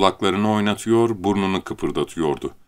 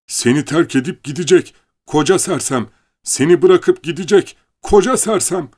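building taunt; 9 semitones higher, 6 dB louder than usual. Acted. Rabbit taunting, rising.

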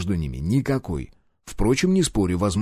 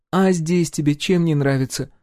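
wife voice; 6 semitones higher than usual. A narrator's reading.

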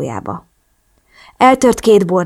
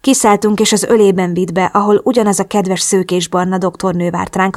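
ordinary delivery, nothing marked

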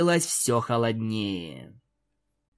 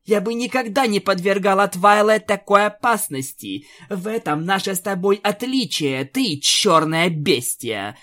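higher, louder growing fury; rising anger; 6 semitones higher, 3 dB louder than usual. Acted. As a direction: let the rage swell to the insult. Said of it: "Луна всё больше наливалась гневом". (с нарастающим гневом)

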